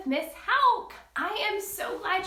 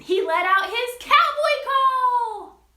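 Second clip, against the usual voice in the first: higher and louder on the cowboy call; building excitement; 6 semitones higher, 8 dB louder, slower than usual. A narrator's reading. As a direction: bring the story to its big moment. Story peak, cowboy call.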